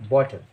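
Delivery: "बॉटल" with open o, hard t; correct pronunciation. Shown as a demonstration. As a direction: repeat "बॉटल" as bot-l. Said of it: "इसको बॉटल कहते हैं".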